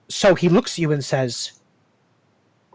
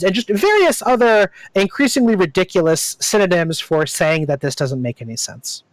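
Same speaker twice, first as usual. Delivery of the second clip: ordinary talk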